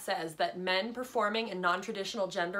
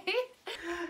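chuckling along; 6 semitones higher than usual. Acted. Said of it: "Kelly was chuckling too".

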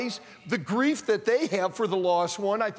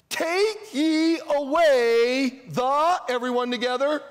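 very slow, loud, drawn-out reading; big slow cue for the crowd to finish; prompting the congregation; 5 semitones higher, 5 dB louder, slower than usual. Real, not acted. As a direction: stretch each word high and leave it hanging. Cueing congregation to supply word.

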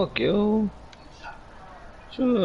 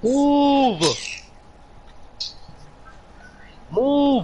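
sharp high yell of move; shouted order; 13 semitones higher than usual. Real, not acted. Gamer yelling at a blocking teammate to move. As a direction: bark it at a teammate in the way.

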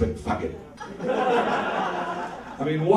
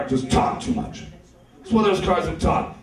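ordinary talk

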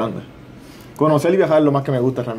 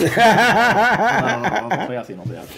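real laughter through a playful no; giggling, bashful protest; laughing denial; 10 semitones higher, slower than usual. Real, not acted. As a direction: laugh it off, protesting you're not like that. Denies bragging while being teased.

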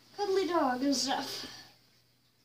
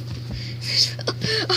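unvoiced breathy laugh, higher; breathless laugh; 6 semitones higher, 4 dB louder than usual. Real, not acted. Laughs at own panic.

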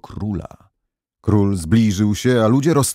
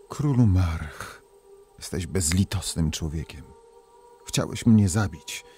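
hushed stern princess; 3 semitones lower, 7 dB quieter than usual. Acted. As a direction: speak down low like a vengeful spirit. Princess speaks her curse to king.

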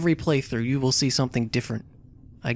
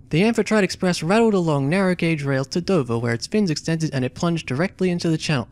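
reading the game text aloud as a story; story narration; 3 semitones higher than usual. A narrator's reading. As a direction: narrate it like an adventure novel. Reads the game's story text.

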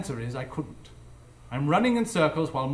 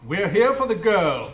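louder, slow, resolute; firm demand; 4 semitones higher, 7 dB louder than usual. Acted. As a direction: stand your ground. Holmes confronts Moriarty.

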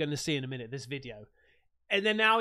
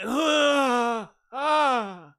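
winded gasps; 4 semitones higher, 8 dB louder than usual. Retold or imitated. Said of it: "when you fall over and you wind yourself".

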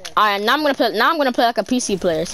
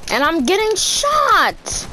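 frustrated whine; 8 semitones higher, slower than usual. Real, not acted. Whines while being shot.

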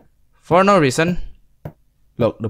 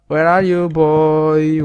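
loud taunting call, higher; mock hunter call; 5 semitones higher, 8 dB louder, much slower than usual. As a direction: call out like a playful stalker. Hunting ghost, taunting call.

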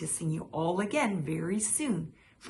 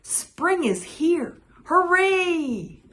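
higher, louder and slowed, a big happy hooray; joyful cheer; 12 semitones higher, 9 dB louder, much slower than usual. Acted. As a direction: cheer out loud for spring. Rabbit cheers the ending.

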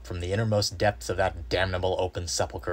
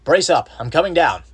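loud, high call to a friend below; shouted resolve; 10 semitones higher, 13 dB louder than usual. A narrator's reading. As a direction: shout down with determination. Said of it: "I formed and shouted a resolution".

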